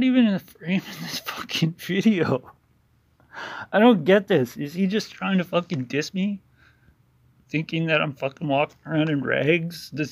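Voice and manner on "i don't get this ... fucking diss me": usual speaking voice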